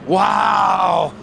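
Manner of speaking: surprised wow. Real, not acted. Reacting to the crash.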